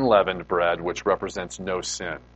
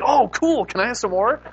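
put-on excited kid; 6 dB louder than usual. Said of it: "the kids, of course, were like".

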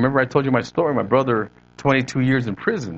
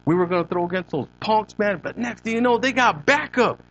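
higher, lighter East L.A. homeboy voice; pitched-up East L.A. street storyteller; East L.A. accent; 7 semitones higher than usual. Retold or imitated. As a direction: imitate an East L.A. homeboy excitedly recalling a fight. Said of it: "He goes".

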